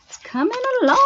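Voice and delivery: high sing-song repeat. Real, not acted. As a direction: lilt it up high like a tune.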